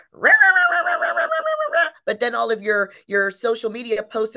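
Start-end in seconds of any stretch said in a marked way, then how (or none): 0.1-1.9 high, loud mock cheering chant; hyped-up cheer. Mocking a loud brand in a business talk, high rah-rah cheer. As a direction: chant it like an over-the-top hype cheer.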